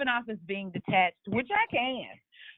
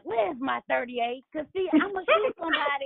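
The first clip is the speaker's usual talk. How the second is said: feisty grandma; 8 semitones higher than usual. Retold or imitated. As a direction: play a grandma daring anyone to cross her. Grandma voiced in story.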